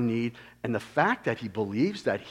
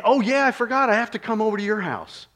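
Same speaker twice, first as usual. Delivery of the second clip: higher, fast, hammed-up oh-yeah-I-forgot; mock forgetful; 6 semitones higher, faster than usual. Preacher mocking the idea that Jesus forgot, lightly. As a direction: play a pretend scatterbrained Jesus, lightly.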